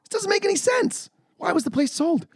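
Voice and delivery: very high squeaky outrage; incredulous high-pitched protest; exasperated disbelief. Retold or imitated. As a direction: re-enact yelling at the screen, voice way up. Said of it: "I paused it and was like".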